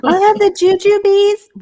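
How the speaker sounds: high, slow put-on order voice; a child at the candy counter asking sweetly; mock customer voice. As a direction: order jujubes in a silly high voice.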